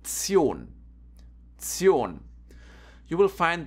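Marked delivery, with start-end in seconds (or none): 0.0-0.7 "Zion" said as a slow tsyohn; "Zion" as one drawn-out syllable, ts-yohn; tion pronunciation demo. Shown as a demonstration. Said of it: "this combination is pronounced". 1.6-2.2 "Zion" said as a slow tsyohn; "Zion" as one drawn-out syllable, ts-yohn; tion pronunciation demo. Shown as a demonstration. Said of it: "this combination is pronounced".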